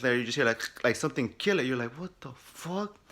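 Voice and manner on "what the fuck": slow, higher, hushed disbelief; mock uneasy bewilderment; retold spooked reaction. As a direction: draw it out softly as if creeped out. Acts reaction to unseen noise.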